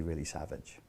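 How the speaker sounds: usual speaking voice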